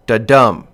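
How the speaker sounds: "Da-dum" with a light "Da" and a loud, punched "dum"; stress on second syllable. Shown as a demonstration. Lecturer demonstrating an iamb to students, "Da-dum" stressed on "dum". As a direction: beat out "Da-dum", weak then strong. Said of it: "one stressed syllable".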